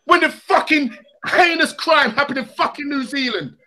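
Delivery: ordinary delivery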